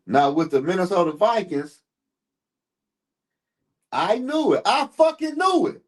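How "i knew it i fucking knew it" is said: excited. Real, not acted. Streamer finds the stats proving his point, triumphant. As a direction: crow that he was right. Stats prove him right.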